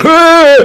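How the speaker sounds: high yelp of alarm; a sudden startled cry from bed. Retold or imitated. Cleric waking under the covers, high startled yelp. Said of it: "He's like".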